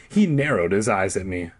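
usual speaking voice

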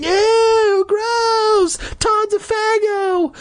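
high shrill schoolkid squeal; kids screaming. A narrator's reading. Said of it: "started pointing and screaming".

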